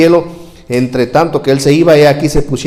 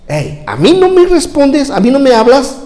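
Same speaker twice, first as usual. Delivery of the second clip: indignant Pilate; 12 semitones higher, 4 dB louder than usual. Acted. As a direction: insist he answer you. Pilate offended by silence. (Pilato indignado, agudo y lento)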